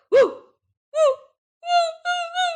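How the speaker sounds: high yelping; dog whimper. A narrator's reading. The dog falls down, high yelps. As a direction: yelp like a dog that fell.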